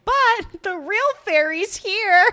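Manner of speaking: high, loud, slow cutesy flourish; mock grand reveal; gushing flirt, imitated. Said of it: "he looks at her and he's like".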